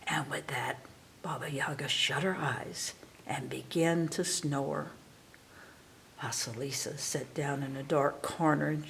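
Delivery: hushed storyteller's whisper. A narrator's reading. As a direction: whisper so the witch stays asleep. Narrator whispers as witch sleeps.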